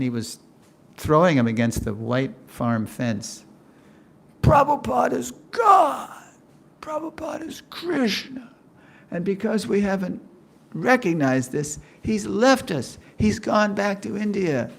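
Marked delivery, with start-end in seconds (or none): 4.4-6.1 high, loud ranting shout; fanatic shout. Retold or imitated. Devotee raging at temple president. 6.8-8.5 high, breathless whispered cry; a fervent whisper-cry; anguished cry. Retold or imitated. Devotee raging at temple president. 9.1-14.7 high, urgent lament; a worked-up devotee grieving his loss; agitated pleading. Retold or imitated. Devotee raging at temple president.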